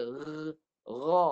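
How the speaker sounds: ra dengan rrrr bergetar dahulu; vibrated ra. Shown as a demonstration. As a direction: buzz the throat then say ra thick. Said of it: "Gegaran suara". (ra tebal bergetar)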